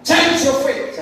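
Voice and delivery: loud, high-pitched preaching shout; forceful exhortation. Real, not acted. Preacher challenging the congregation's faith, forceful exhortation. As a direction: fire out the challenge to them.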